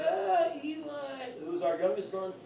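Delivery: high, frantic mock wife; hammed-up panic at the kids; freaking out. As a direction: squeal it like a panicked mom. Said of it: "she was freaking out".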